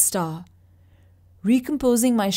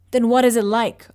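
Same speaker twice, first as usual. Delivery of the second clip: a loud, higher furious shout; 3 semitones higher, 7 dB louder than usual. Acted. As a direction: yell it straight at the old man. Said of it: "I shouted".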